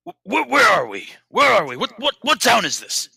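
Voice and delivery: high, loud, stammering panic; frantic panicked questions. Acted. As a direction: grab them and demand answers frantically. Grabbing stranger, panicked questions.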